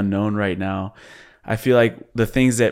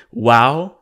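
quoting her mother; 8 dB louder than usual. Retold or imitated. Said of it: "the last words that she gave you was".

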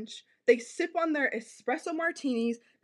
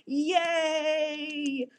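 mock cheer. Mocking zesty men cheering.

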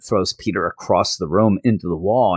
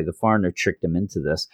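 ordinary talk, nothing marked

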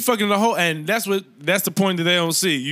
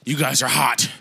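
low put-on voice; 5 semitones lower than usual. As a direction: drop into a low joking character. Acted low voice role.

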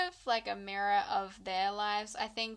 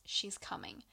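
urgent hushed warning; desperate whisper; 6 dB quieter than usual. Retold or imitated. Madame Labelle warning Lou, desperate whisper. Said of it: "said desperately to her".

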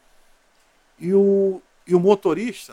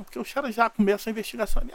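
ordinary talk, nothing marked

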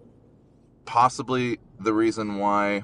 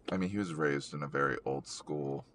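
ordinary talk, nothing marked

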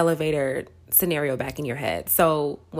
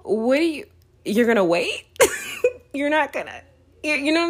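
higher, louder, half-laughing disbelief; mock-stunned that God would just wait; you're kidding me, higher and a bit louder; incredulous disbelief; 9 semitones higher, 4 dB louder than usual. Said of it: "And I'm like".

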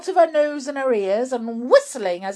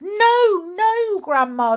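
high, loud alarm; urgent boy's protest; crying out; 11 semitones higher, 8 dB louder, slower than usual. Acted. George pulls the cup away from Grandma, alarmed cry. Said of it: "George cried out".

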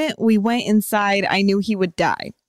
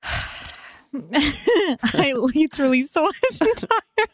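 slow, higher mock Russian; bad Russian accent; 4 semitones higher, much slower than usual. Retrying the story while fighting giggles, slow bad Russian accent. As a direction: fake a Russian accent, drawn out. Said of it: "to the end of the story with this accent".

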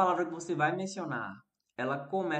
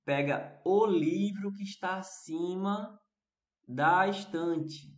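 slow, deliberate instruction; slow demo quote; slower than usual. Shown as a demonstration. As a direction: say "pega o livro que está acima da estante" slowly, word by word. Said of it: "Mas se eu disser".

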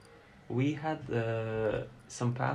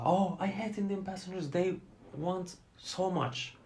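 annoyed colleague; 6 semitones higher than usual. Retold or imitated. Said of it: "they hate".

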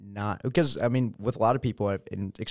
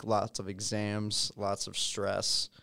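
slow weary; much slower than usual. Acted. Drawn-out weary list.

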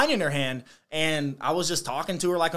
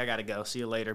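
quieter, lower quick goodbye; mock hushed exit; 6 semitones lower, 8 dB quieter, faster than usual. Leaving the woman when the boss calls, a hushed goodbye. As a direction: slip out with a muttered goodbye. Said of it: "and I was like".

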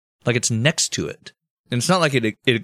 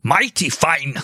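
higher, punched-out mock dictator voice; Hitler impersonation; 5 semitones higher, slower than usual. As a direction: bark it like a parody dictator. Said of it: "that was my Hitler impersonation".

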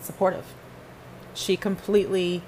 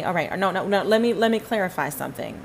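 ordinary delivery

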